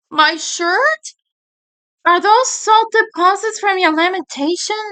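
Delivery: mildly goofy, puzzled voice; lightly voiced dopey friend.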